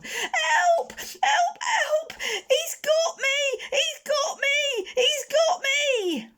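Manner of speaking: very high, loud, slow cries for help; terrified screaming. Acted. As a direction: scream in terror as he falls. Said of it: "a shrill scream of terror".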